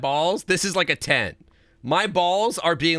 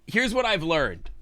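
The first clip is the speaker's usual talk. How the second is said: higher start dropping at the end; falling restated read; 4 semitones higher than usual. Real, not acted. Streamer repeating the note's line, falling restated read. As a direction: restate it with a closing fall.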